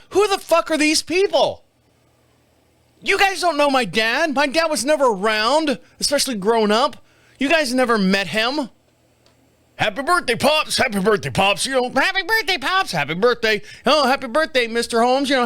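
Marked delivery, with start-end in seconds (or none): 0.1-8.7 high, incredulous outburst; indignant rant. Retold or imitated. Comic rant at Facebook well-wishers, indignant. Ranting retort to Facebook strangers. 9.8-11.6 lightly voiced chirpy greetings; mock well-wishers. Facebook strangers greeting his dad, lightly mocked. 11.9-15.3 high, gushing birthday greetings; mock well-wishers. Facebook strangers greeting his dad, mockingly gushing.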